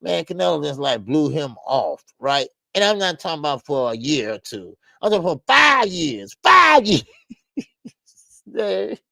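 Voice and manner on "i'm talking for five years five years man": louder, higher, laughing through the word five; laughing disbelief. Real, not acted. Host laughing at a champion's five-year dodge, incredulous.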